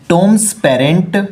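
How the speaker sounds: very slow, word by word; slow read-aloud. Shown as a demonstration. Teacher rereading the corrected sentence, very slowly.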